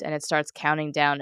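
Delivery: normal talking voice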